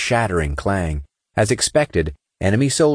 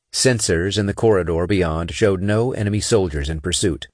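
usual speaking voice

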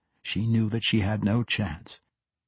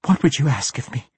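higher, louder, shaken question; gasped question; 5 semitones higher, 5 dB louder than usual. A narrator's reading. Captive just released from the dagger, gasped question. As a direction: gasp it out, pitched up. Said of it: "Callahey gasped".

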